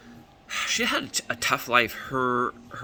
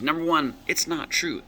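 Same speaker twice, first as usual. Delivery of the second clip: indignant protest; 6 semitones higher than usual. Retold or imitated. Client protests her bad review.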